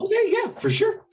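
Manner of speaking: put-on very high chirpy girl; sweet eager girl; high little girl voice.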